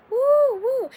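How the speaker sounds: loud, high-pitched "woo woo"; high, loud party-style whoops; mock hyper whoop. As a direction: whoop it up to show a manic high. Acts out feeling more hyper.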